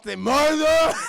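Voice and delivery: high, very loud wail of a name; hammed-up anguished cry; mock wailing. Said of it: "he starts wailing".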